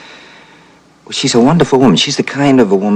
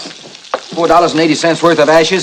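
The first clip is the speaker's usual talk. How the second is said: exasperated; 8 semitones higher than usual. Acted. Felix upset about ruined meatloaf.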